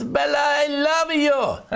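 over-the-top romantic cry; mock love declaration. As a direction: gush it out like a lovestruck husband. Mock gift-giver declaring love.

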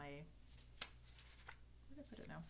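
ordinary talk, nothing marked

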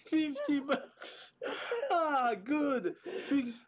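high, slow ecstatic shriek of a win; drawn-out shout of joy over the prize; shrieking jackpot winner. Real, not acted. Dad wins fifty on scratch ticket.

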